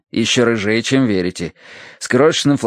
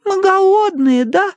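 put-on high lisping baby talk; cutesy baby talk; 3 dB louder, slower than usual. A narrator's reading. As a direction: coo to a hungry dog. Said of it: "сюсюкала Тосси".

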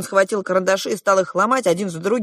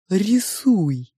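slow, drawn-out character voice; probing two-faced Sofya; 3 semitones higher than usual. A narrator's reading. Sofya questions the girl about her parents, probing. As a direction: probe slowly, stretching the words.

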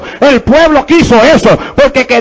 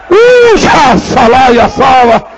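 ecstatic tongues; much slower than usual. Real, not acted. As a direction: burst out as if seized by the Spirit.

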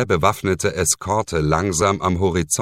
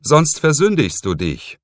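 pressing, higher and louder; insistent; 10 semitones higher, 5 dB louder than usual. Acted. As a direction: insist without yielding. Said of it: "in unnachsichtigem Ton". (eindringlich und unnachgiebig)

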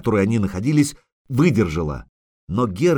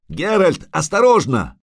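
higher-pitched cry of alarm; sudden raised cry to watch out; urgent warning shout; 6 semitones higher, 4 dB louder than usual. Acted. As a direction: call out a warning. Warning cry as rocks fall.

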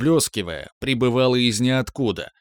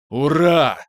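louder, joyful shout; shouting hurrah; 4 semitones higher, 5 dB louder than usual. A narrator's reading. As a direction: yell it in triumph. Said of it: "заорал Виктор".

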